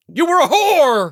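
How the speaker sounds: loud, high, drawn-out yell; hammed-up yell at an ex in a quarrel; mock shouted accusation. Retold quarrel, acted accusation.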